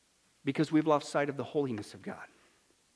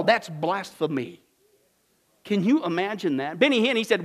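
ordinary talk

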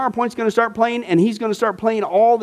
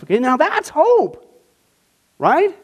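usual speaking voice

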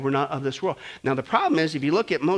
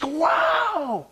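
long, high wow; awestruck; 12 semitones higher than usual. Acted. Preacher marvels at the coming kingdom, awestruck. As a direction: stretch it out in amazement.

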